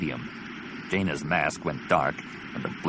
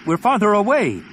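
high, loud urgent shout; yelling; 13 semitones higher, 7 dB louder than usual. Acted. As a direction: shout it over the battle. Yelling over battle noise.

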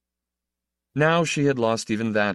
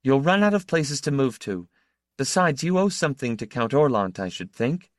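a hint of Laura, firm and cool, voice a little higher; lightly voiced young woman; 6 semitones higher than usual. A narrator's reading. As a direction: advise him coolly, a touch superior. Narrator voices Laura advising Berke.

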